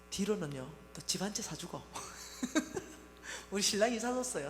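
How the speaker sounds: normal talking voice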